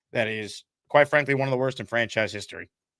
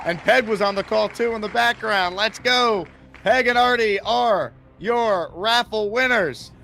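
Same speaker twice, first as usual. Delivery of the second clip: loud, high, thrilled announcement; excited; 7 semitones higher, 6 dB louder, slower than usual. Real, not acted. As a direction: cheer the winners' names. Announcing raffle winner.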